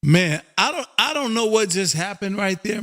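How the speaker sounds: ordinary delivery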